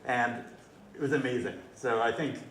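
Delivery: usual speaking voice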